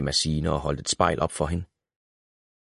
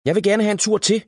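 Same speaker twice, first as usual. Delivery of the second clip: high, louder eager call; child calling out; 14 semitones higher, 4 dB louder than usual. A narrator's reading. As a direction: call out for one more ride. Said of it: "råbte Willy". (barnligt råb)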